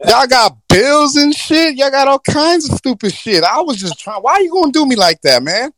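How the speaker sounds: hammed-up whiny genie; indignant genie. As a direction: protest being pushed into the human world. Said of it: "He gonna be like".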